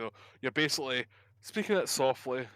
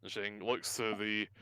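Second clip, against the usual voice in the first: ordinary talk, nothing marked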